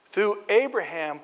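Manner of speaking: slowed, emphatic sermon delivery; preacher building the story of Abraham; measured preaching. Acted. Preaching about Abraham's calling.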